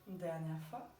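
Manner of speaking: unhurried relaxation voice with long pauses; guided meditation. Acted. Yoga teacher guiding listeners lying in Shavasana, calm guided meditation. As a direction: guide them gently into rest, phrase by phrase.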